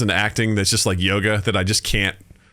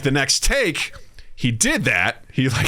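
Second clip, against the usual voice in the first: ordinary delivery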